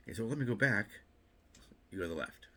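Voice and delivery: normal talking voice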